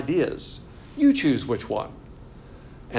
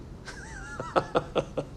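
high laugh; 8 dB quieter than usual. Real, not acted. Laughs at "not fair" to creator.

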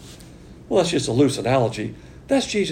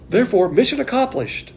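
much higher, ringing declaration of victory; triumphant, higher mission accomplished; 8 semitones higher, 3 dB louder than usual. Real, not acted. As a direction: announce it like a job done.

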